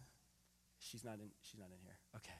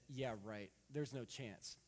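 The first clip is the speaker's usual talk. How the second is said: eye-rolling dismissal; 4 semitones lower, 6 dB quieter, slower than usual. Retold or imitated. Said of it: "she rolled her eyes and said".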